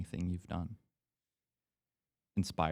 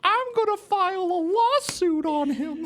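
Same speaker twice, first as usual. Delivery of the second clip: mock whiny viewer; 12 dB louder than usual. Imitating a suing viewer.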